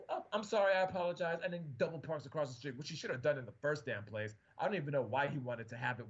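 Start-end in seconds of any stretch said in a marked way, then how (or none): 0.1-1.4 mock apology. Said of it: "the guy apologized to my boss".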